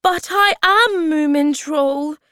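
high, loud insistent protest; crying out. A narrator's reading. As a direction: insist on who you are, loudly. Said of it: "he cried".

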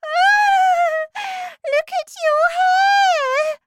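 very high, loud horrified wail; screaming. Acted. Wife pointing at her husband's hair, screaming. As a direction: shriek and point in horror. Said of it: "was screaming".

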